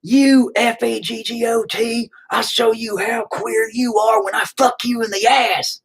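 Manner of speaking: high, slow mocking shout. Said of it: "they'd be like".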